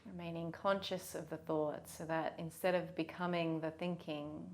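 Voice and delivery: soft, unhurried meditation voice; guided meditation. Real, not acted. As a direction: guide them gently, leaving long pauses.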